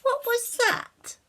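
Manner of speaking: high, alarmed little fairy voice. Acted. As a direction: squeak it out alarmed. Fairy hears a noise.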